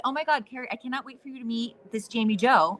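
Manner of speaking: ordinary delivery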